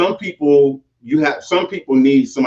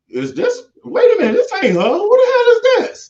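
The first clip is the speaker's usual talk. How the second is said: shocked viewer. Retold or imitated. A stunned viewer, not recognizing her, shrieks high. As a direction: gasp it out like you can't believe your eyes. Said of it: "a viewer probably looking at you".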